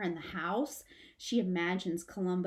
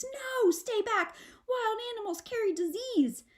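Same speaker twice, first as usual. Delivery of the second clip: shriek; 12 semitones higher, 3 dB louder than usual. Acted. Mother shrieking at Izzy to stay away, high and afraid. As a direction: shriek a warning. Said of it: "her mother shrieks".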